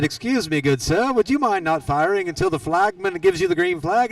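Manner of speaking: a lightly put-on, bad British accent, rising high at the end; hammy British gentleman asking politely; playful, overly polite bad British accent. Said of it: "That's the worst British impression".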